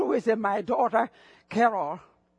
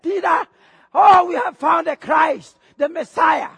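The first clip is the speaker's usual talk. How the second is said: loud, raised cry of discovery; excited announcement; 4 semitones higher, 6 dB louder than usual. Retold or imitated. Andrew telling Peter they found the Christ, excited. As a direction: burst out with the great news, louder.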